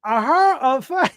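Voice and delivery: false start in a mock Boston accent. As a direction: try a hammed-up Boston accent. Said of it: "bring out my best Boston".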